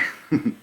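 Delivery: short real nervous laugh; a quick laugh from nerves; brief uneasy chuckle. Real, not acted. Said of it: "I don't know why I'm laughing".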